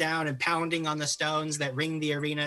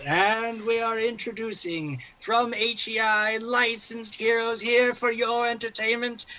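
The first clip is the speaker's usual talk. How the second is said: booming arena announcer; 9 semitones higher than usual. Acted. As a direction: project grandly to the whole arena, holding each phrase aloft. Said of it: "a loud sort of booming voice filter from".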